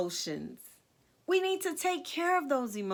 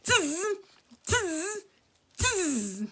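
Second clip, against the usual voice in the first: higher-pitched buzzing monkey chatter; mimicking monkeys; 6 semitones higher, much slower than usual. A narrator's reading. Monkeys shaking hands back at the peddler, teasing chatter. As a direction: tease him with short monkey noises. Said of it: "Let's do some monkey business".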